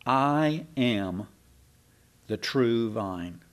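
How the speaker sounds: slow and deliberate, "I am" given weight; "I am" drawn out, pause, then slow "the true vine"; emphatic. Retold or imitated. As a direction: declare "I am" with slow, separate words. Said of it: "And it's emphatic".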